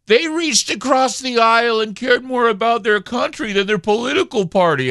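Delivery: high, sneering mock voice of his critics; parroting his critics in a high whiny voice; mocking Trump haters. Radio host mocking listeners who praise Cheney, in a high sneer. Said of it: "would say things like".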